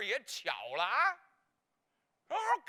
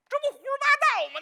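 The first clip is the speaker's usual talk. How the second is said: higher, louder, fast indignant outburst; mock outrage; 12 semitones higher, 8 dB louder, faster than usual. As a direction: fire it back, scandalized. Outraged retort in quote.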